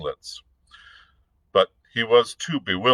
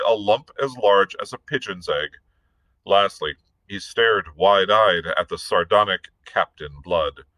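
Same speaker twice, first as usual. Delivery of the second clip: ordinary delivery, nothing marked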